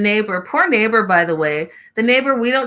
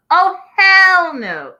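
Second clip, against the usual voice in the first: much higher, louder, slow hell no; full yell at the TV; 10 semitones higher, 7 dB louder, much slower than usual. Retold or imitated. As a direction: drag out hell no as an outraged yell. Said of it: "This is the part that I screamed at the T V".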